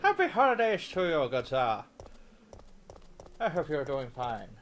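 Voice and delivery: loud, lilting Irish greeting; hearty Irish accent, pitch leaping up; accidental Irish accent. Pretend. Streamer greeting a viewer for the holidays, put-on Irish accent. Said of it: "why am I sounding Irish?".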